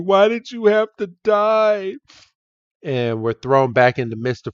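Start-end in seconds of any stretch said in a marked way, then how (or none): 0.0-1.9 high, hammed-up lament; mock wailing grief. Mourner at Growlithe's grave, mock wailing. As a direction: wail it high like an acted mourner. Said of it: "is crying and paying his respects".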